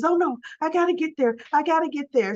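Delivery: high, quick urgent mock panic; panicked rush. [name] realizing she must reach the precinct, mock panic. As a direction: hurry out in alarm.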